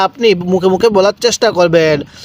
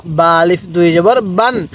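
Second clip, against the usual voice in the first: ordinary talk, nothing marked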